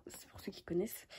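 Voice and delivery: very soft, voiceless whisper; whispered aside. Real, not acted. Vlogger adding a note for those who know the saga, whispered.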